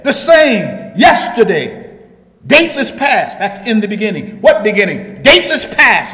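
loud preacher's cry, punching each phrase; full-force preaching. Real, not acted. Preacher proclaiming Christ unchanged to the congregation, full-force preaching.

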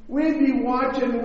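normal talking voice

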